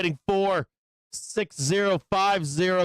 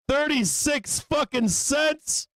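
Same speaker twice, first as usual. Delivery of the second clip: amazed shout; 8 semitones higher than usual. Real, not acted. Amazed at the price.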